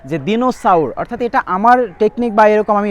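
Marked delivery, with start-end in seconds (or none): 0.3-0.9 দিনোসাউর said as spelt, di-no-sa-ur; spelling pronunciation. Shown as a demonstration. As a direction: sound out দিনোসাউর letter by letter. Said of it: "ধরো আমি এভাবে মনে রাখি". (বানান অনুযায়ী উচ্চারণ: দিনোসাউর)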